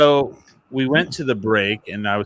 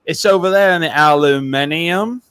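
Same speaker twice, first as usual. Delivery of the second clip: mock Aussie; 5 semitones higher, 3 dB louder than usual. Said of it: "he goes, he goes".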